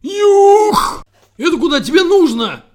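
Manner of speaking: very high, loud breathless shriek; sparrow's scream. A narrator's reading. Sparrow screams at the birds, choking on his own cry. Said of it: "Задыхаясь от собственного крика".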